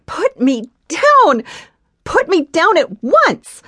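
high, loud desperate demand; gasping demand. Acted. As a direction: gasp out the order, breathless and frantic. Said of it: "she gasped".

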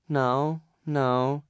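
ordinary delivery, nothing marked

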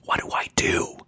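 soft, voiceless whisper; nearly silent anxious whisper; mouthed whisper. A narrator's reading. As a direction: barely breathe the question. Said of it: "turned to me and mouthed".

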